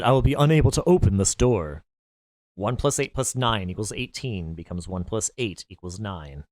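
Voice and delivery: a lightly voiced impatient villain, commanding; lightly voiced cold command, growing impatient; cold Ace. Ace demands the woman at gunpoint, lightly voiced impatience.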